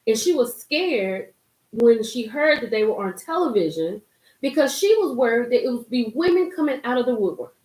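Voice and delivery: usual speaking voice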